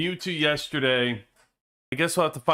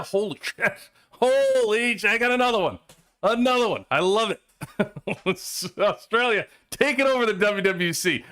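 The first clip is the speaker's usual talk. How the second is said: real excited delight; 6 semitones higher than usual. Real, not acted. Another Australian viewer joins.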